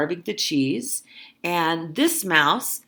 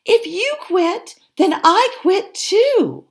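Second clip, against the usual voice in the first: small voice; 13 semitones higher, 6 dB louder than usual. Acted. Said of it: "a small voice came".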